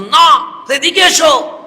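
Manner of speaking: high, forceful acted summons; loud calling out. Preacher acts an exchange in the story, calling out loudly. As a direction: call him over at full voice.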